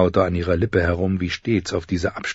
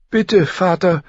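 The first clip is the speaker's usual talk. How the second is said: put-on high, pleading girl; tearful plea; 12 semitones higher, 5 dB louder, slower than usual. Sansa begs father not to send them away, pleading. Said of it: "brachte Sansa schließlich hervor".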